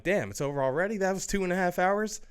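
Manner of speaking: retelling his own disbelief, rising high at the end; mock surprise. Reviewer reliving the end of the movie, in mock surprise. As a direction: act amazed that the film is already over. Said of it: "I'm like".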